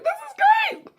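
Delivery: very high, loud delighted squeal. Retold or imitated. Over escargot in shells, delighted squeal. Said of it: "I'm just like".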